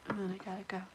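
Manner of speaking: soft, low murmur; quiet voice. Real, not acted. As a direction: say it softly under your breath. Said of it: "I have to make it quiet".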